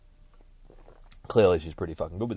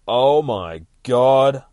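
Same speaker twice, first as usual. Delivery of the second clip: loud, very slow, raised exclamation; shocked reveal; 4 semitones higher, 11 dB louder, much slower than usual. Acted. Storyteller turns the page on the reveal, loud slow shock. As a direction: draw it out big and loud, astonished. Reveal of dead friend Vlad.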